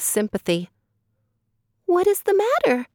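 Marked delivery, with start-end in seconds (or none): 1.8-2.9 a tiny caring creature asking; little frog, concerned. Acted. Frog asks the crying girl what is wrong, very high.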